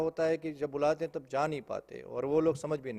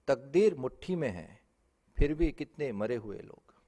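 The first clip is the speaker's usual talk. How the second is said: poetry recitation; 4 dB louder than usual. Acted. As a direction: recite each phrase deliberately, with pauses between. Recites own poem on stage. (मंच पर ठहर-ठहर कर काव्य पाठ)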